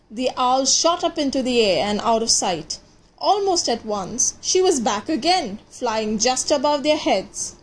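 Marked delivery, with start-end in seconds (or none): none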